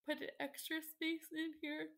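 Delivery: yawning while talking; words stretched by a yawn. Real, not acted. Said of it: "I'm yawning".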